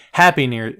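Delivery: ordinary delivery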